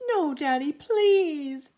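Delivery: high, loud desperate plea; begging. A narrator's reading. Children begging father not to send the dog away, high and loud. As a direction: beg with everything you have. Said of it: "begged Betty and Billy".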